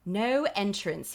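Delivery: put-on slow, stiff English accent guard. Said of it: "I say in an English accent".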